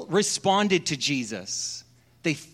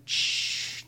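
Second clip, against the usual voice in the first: hush; 5 dB quieter than usual. Acted. Bartender hushes the crowd.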